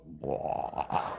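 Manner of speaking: breathy unvoiced groan; lazy groan. Husband sprawled in bed groaning at his wife. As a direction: let out a lazy belly groan. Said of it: "laying in bed with that big stomach going".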